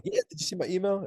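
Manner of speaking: high, quick eager question; reenacting your over-eager self; hyped. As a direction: pounce with an excited question. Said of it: "I was still hyped".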